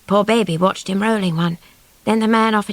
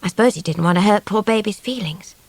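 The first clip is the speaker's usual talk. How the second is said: ordinary delivery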